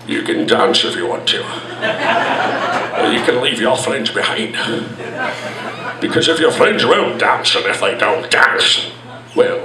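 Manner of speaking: low, dramatic Scottish reading; Sean Connery. Retold or imitated. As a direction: recite it like a brooding Scot. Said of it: "Impressions like Sean Connery dramatically reading".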